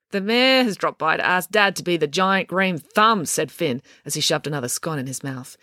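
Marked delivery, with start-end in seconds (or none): none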